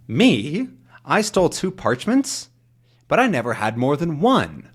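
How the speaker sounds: high, questioning disbelief; mock-astonished denial, pitch leaping up; incredulous protest. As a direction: act taken aback at being accused. Tintin protests a false accusation.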